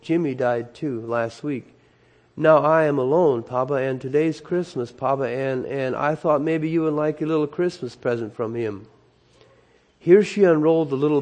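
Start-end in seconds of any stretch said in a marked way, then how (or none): none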